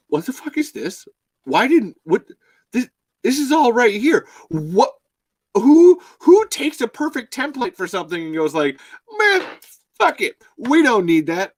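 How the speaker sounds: high, loud incredulous rant; mock outrage. Imagined viewer griping at the show's makers, incredulous.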